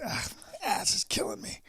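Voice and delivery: breathy whispered groan of pain; whispered complaint. Retold or imitated. The brother telling dad, a whispered moan of pain. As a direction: whisper it like it hurts. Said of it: "He goes".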